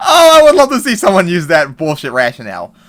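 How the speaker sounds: loud, laughing through the words; laughing scorn. Real, not acted. Vlogger scoffing at rioters' excuse, loud laughing scorn. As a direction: laugh it out in disbelief.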